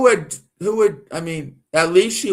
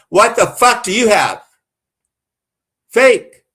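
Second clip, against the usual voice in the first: real anger, louder and higher on the last word; getting worked up; 5 semitones higher, 6 dB louder than usual. Real, not acted. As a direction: snap at him, then spit out the last word loud. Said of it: "I don't know why I'm getting worked up".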